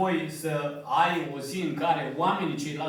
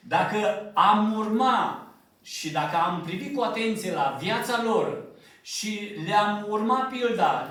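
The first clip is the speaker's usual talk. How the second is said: impassioned pulpit delivery, slowing on the last words; fervent preaching; 2 semitones higher, 4 dB louder than usual. Acted. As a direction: preach it, lifting the congregation. Preaching at full force.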